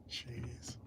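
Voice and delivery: breathy whispered chuckle under the breath; whispered laugh. Real, not acted. Laughing to himself alone.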